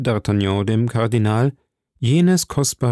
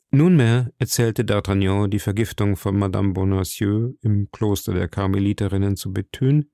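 ordinary delivery, nothing marked

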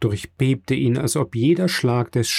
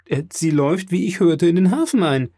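higher-pitched rush of news; excited calling; 6 semitones higher than usual. A narrator's reading. Maximilian bursting in to his father, excited calling. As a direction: report it in rising excitement. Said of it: "trat ebenfalls ein und rief".

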